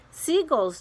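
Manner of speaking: ordinary delivery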